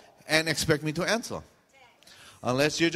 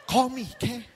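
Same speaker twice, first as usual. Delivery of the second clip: high sing-out sign-off; fake cheerful invitation; 8 semitones higher than usual. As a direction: chirp it insincerely.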